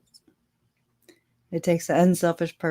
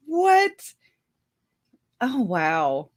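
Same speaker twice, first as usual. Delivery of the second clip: startled, pitched way up; surprised; 7 semitones higher, much slower than usual. Real, not acted. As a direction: react in sharp disbelief. Surprised reaction to chat comment.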